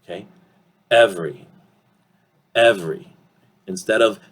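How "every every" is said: "Every" shortened to EV-ree; reduced form. Shown as a demonstration. Teacher showing reduction, "Every" shortened to EV-ree. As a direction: say "Every" in two syllables, dropping the middle e. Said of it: "this is how it will sound".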